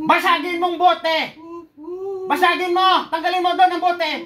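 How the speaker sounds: loud, high, forceful order; urgent exorcist's shout; shouted command. Real, not acted. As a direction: command the witch at full voice. Healer orders witch to break bottle.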